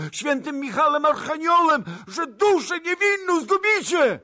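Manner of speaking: frantic high-pitched plea, shouted loud; przeraźliwy krzyk. A narrator's reading. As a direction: beg for your life at the top of your voice. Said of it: "krzyknął przeraźliwie". (przeraźliwy, błagalny krzyk)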